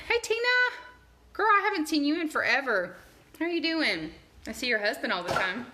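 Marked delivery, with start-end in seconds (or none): 0.1-4.1 excited greeting. Pretend. Greeting imagined friend, mock.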